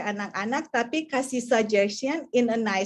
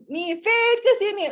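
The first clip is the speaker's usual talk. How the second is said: louder, higher mock call to the child; exasperated calling; 7 semitones higher, 4 dB louder than usual. A parent calls Faith again, exasperated and louder. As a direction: call her the fifth time, losing patience. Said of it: "udah nada".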